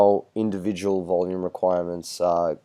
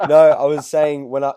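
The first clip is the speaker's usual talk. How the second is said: laughing through the words, louder and higher; 6 semitones higher, 10 dB louder than usual. Real, not acted. Host laughing off his own name mix-up, laughing. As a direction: laugh it off while correcting yourself.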